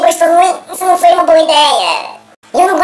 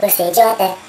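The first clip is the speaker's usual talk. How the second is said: disguised deep voice; 8 semitones lower, 4 dB quieter than usual. Pretend. Insulting viewer in changed voice.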